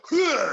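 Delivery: high, loud hacking cough; sharp cough. Retold or imitated. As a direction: hack it out hard. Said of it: "that's what if you're like".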